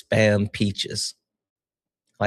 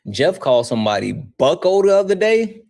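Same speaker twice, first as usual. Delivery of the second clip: normal talking voice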